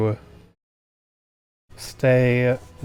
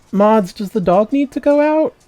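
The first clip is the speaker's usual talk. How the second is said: high, louder put-on voice calling to the mods; mock voice addressing mods; 9 semitones higher, 6 dB louder than usual. Streamer jokingly asks her mods about the dog in a high put-on voice. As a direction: play a silly character asking the mods. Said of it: "calling you mods is fun".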